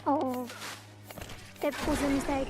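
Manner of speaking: usual speaking voice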